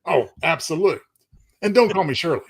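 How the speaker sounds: usual speaking voice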